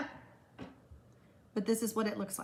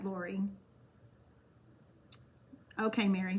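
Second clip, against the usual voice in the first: ordinary talk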